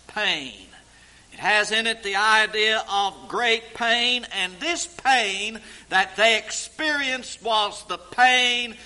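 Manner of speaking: loud, high-pitched preaching about heart pain; full-force preaching. Acted. Preacher to congregation on being pierced through, fervent loud preaching. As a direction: preach it out with building fervor.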